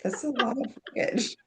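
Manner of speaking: high, soft mock-startled greeter; airport staff voice. Airport staff eyeing six bags of shoes, high mock surprise.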